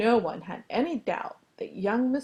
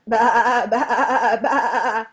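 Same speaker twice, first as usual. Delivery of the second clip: very loud, wavering baas; lamb bleating; 2 semitones higher, 14 dB louder, much slower than usual. A narrator's reading. Lamb trying to crow for rooster, loud bleating. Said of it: "The lamb went".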